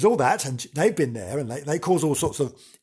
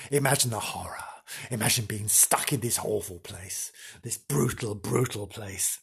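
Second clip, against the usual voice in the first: low hushed ghost-tour dread; hushed horror; 5 semitones lower, 6 dB quieter than usual. Acted. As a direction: draw them into the dark dread. Imagine the horror, spooky.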